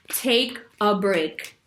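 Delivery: "Take a break." slowly spaced, each word deliberate; slow firm advice. Shown as a demonstration. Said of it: "Say nothing".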